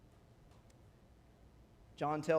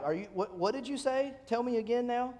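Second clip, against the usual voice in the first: higher-pitched, eager questioning; incredulous double-take; 6 semitones higher than usual. Acted. David asks the men to repeat the reward, incredulous. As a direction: ask as if you can't believe your ears.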